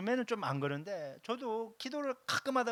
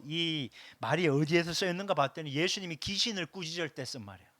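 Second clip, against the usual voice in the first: ordinary talk, nothing marked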